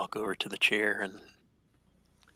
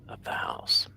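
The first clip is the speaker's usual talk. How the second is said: whispering; slower than usual. Real, not acted. Player whispering a thought.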